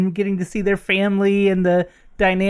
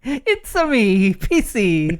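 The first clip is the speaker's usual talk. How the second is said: drawn-out cartoon Italian plumber voice; mock Mario catchphrase; slower than usual. Co-host joking about Switch versus PC, mock Mario. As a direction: announce yourself in a slow, put-on Mario voice.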